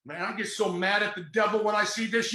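normal talking voice

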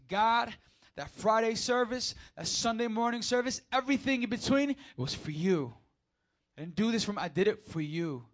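high, loud heartfelt cry to God; soaring preacher's plea; impassioned plea. Acted. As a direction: plead it out with full conviction. Preacher voicing his plea to God.

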